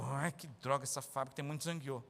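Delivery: low, soft whiny grumble; grumbling worker. Retold or imitated. Preacher mocks a worker complaining about the factory, low whiny grumble. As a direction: moan like a never-satisfied worker.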